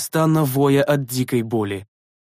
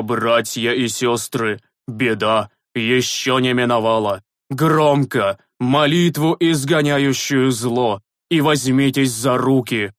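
commanding call; slower than usual. A narrator's reading. Priest commanding prayers in battle.

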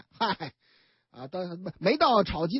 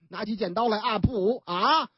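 ordinary delivery

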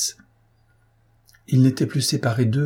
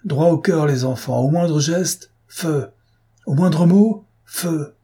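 high, raised firing orders; implacable command; 6 semitones higher, 4 dB louder, slower than usual. A narrator's reading. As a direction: drill the firing squad with each condition, then the order. (ordres implacables, voix haute et tendue)

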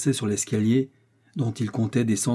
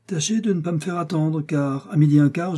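high, then louder menace; threat; 6 semitones higher, 3 dB louder than usual. A narrator's reading. Athos threatening D'Artagnan over the duel. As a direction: warn him with a cold threat.

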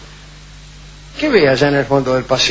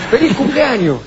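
1930s cartoon; 8 semitones higher than usual. Shown as a demonstration. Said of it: "con voz de dibujo animado".

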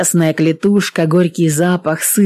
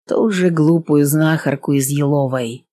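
usual speaking voice

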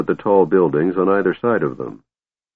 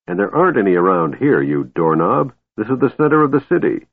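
fast, exasperated lecturing; impatient; 2 semitones higher than usual. Acted. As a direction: rattle off the obvious. Dwarf scolding the Kender.

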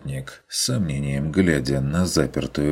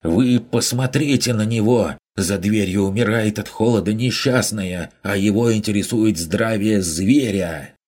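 higher and louder indignant merchant; indignant outburst; 6 semitones higher, 4 dB louder than usual. A narrator's reading. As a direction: rise up in outrage. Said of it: "возмущённо приподнялся с места". (возмущённый купец, громко и выше)

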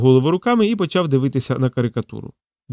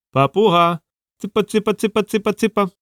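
high, even, repetitive chirpy calling; coaxing bird call; 7 semitones higher than usual. A narrator's reading. Drozd lures the parrot, high coaxing call. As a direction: call a pet bird over.

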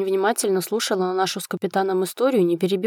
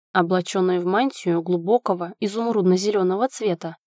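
plain reading voice

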